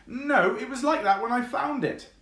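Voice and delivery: high and protesting, pitched well up; innocent fox. Acted. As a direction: plead innocence in a squeaky fox voice.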